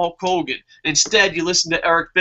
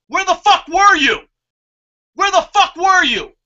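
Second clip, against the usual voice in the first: much higher, louder angry shout; angry rant; 11 semitones higher, 5 dB louder than usual. Real, not acted. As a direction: yell the question at them in frustration. Ranting angrily at the company.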